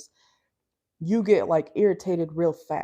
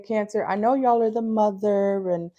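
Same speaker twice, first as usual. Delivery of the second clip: ordinary talk, nothing marked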